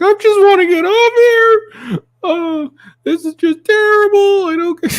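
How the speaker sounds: high, slow, hammed-up fake sobbing; mocking the hysterical lady from the plane video, high fake crying. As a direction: wail it like the panicking passenger. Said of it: "She's like".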